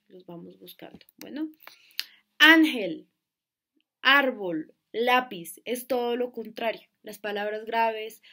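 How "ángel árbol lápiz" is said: "Ángel, árbol, lápiz" loud on ÁN, ÁR, LÁ; stress on penultimate syllable. Shown as a demonstration. Teacher introducing graves words, "Ángel, árbol, lápiz" hit loudly on first syllables. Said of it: "lleva el acento en la penúltima sílaba".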